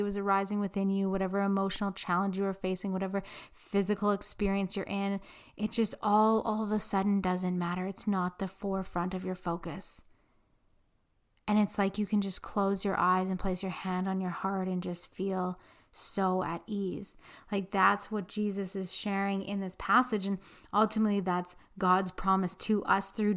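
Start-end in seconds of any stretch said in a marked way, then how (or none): 6.4-17.1 soft meditative. Acted.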